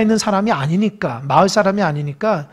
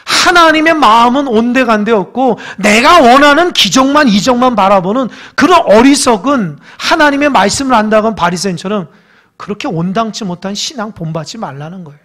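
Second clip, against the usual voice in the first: ordinary talk, nothing marked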